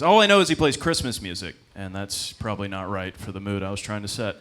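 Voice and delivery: ordinary talk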